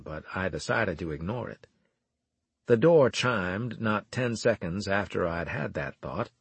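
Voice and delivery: plain reading voice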